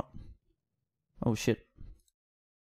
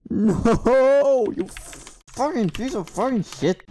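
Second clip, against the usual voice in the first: loud raging scream at the game; furious shout; 5 semitones higher, 6 dB louder than usual. Real, not acted. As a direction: let it all out, a big loud curse. Raging at failure.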